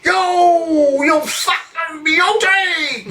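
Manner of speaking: high hammed-up cheering shout; retold cheer. To the crowd as Dad pots one, a shouted cheer. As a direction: whoop it up across the pub. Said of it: "I'm just like".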